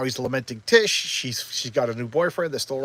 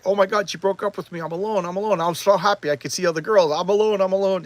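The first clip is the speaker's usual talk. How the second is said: higher-pitched mock panic; freaking out; 5 semitones higher, 5 dB louder than usual. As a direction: imitate someone freaking out, pitched up. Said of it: "now he's freaking out".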